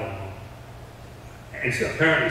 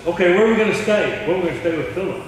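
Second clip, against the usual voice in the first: higher, louder eager asking; Paul asks keenly, pitched up; Paul wondering where they'll stay, eager and raised; eager question; 5 semitones higher, 5 dB louder, faster than usual. Acted.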